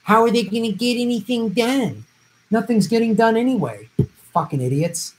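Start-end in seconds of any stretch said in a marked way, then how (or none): 0.0-2.1 high, sneering mock voice; mock the excuse of third-party doubters; mocking voters' excuse. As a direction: parrot the excuse back with scorn. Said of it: "and say".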